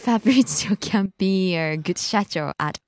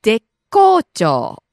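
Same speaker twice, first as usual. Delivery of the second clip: 絶好調 said slowly and clearly, louder; nice and slow; 6 dB louder, slower than usual. Shown as a demonstration. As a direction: stretch 絶好調 out for the learner. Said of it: "Give it to us nice and slow".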